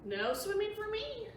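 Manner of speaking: put-on high little-girl voice refusing; girl's high, firm no; child refusing. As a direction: pipe it up like a stubborn little girl. Nellie refusing in read story.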